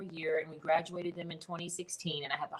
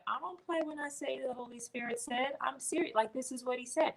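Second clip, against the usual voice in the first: higher, softer, mock-earnest protest; insistent reply; 7 semitones higher, 6 dB quieter than usual. Retelling her answer to doubting customers, high mock-earnest insistence. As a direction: insist you mean it, half playful. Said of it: "I was like".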